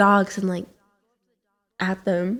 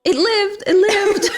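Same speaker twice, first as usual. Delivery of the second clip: laughing; 9 semitones higher, slower than usual. Real, not acted. Reassuring about the bird, laughing.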